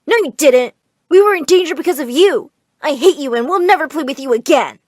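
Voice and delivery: put-on loud, heated child's retort; acted sibling outburst; angry rejection. A narrator's reading. Brother angrily rejecting sister.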